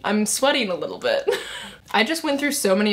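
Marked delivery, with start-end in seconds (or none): none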